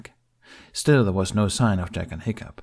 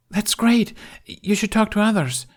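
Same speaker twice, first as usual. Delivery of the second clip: high, loud delighted rush; bubbling over with relief; a kid thrilled his plan works; excited; 13 semitones higher, 4 dB louder than usual. A narrator's reading. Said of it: "He laughed".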